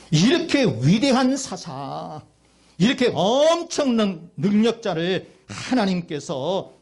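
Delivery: stretched, ringing sermon emphasis; emphatic preaching. Real, not acted. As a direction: draw out the big words for the congregation. Preaches to the congregation.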